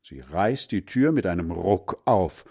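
usual speaking voice

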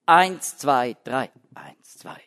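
Eins, zwei, drei counted aloud, then eins, zwei dropped to a whisper; counting the meter. Shown as a demonstration. Lecturer demonstrating the lament meter, three beats voiced and two whispered. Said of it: "das Typische für Klagelied ist drei plus zwei".

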